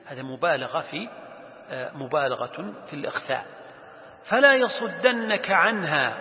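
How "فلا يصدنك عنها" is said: higher, louder, slow recitation; Quran recitation. Acted. Warning to Moses read to students, reciting slowly. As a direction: project the verse with long vowels. Recites next part of verse.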